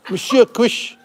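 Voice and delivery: lightly put-on French accent on "Monsieur Quiche"; mock French. To the kitten, a jokey mock French accent. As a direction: play at being a Frenchman, rising at the end. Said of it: "you are French".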